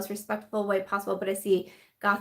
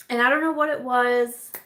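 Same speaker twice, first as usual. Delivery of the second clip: ordinary talk, nothing marked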